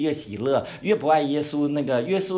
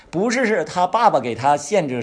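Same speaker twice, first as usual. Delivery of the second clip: ordinary talk, nothing marked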